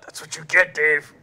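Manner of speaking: Moonbot voice. Retold or imitated. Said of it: "Seer Storm's been chastised by Moonbot".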